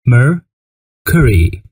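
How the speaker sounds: drawn-out word. Shown as a demonstration. Teacher stretching "Mercury" so pupils can repeat it. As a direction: say "Mercury" very slowly, every syllable stretched. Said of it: "repeating planet names for students".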